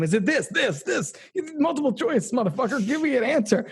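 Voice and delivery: hammed-up frustrated villain pressing for an answer; imitating Smith losing patience; exasperated Smith. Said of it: "He's so frustrated".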